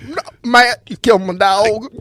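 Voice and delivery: high, loud mock Southern plea; Jeff Hardy. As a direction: holler it as a hammed-up impersonation. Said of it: "his voice sounds like a Skull Can".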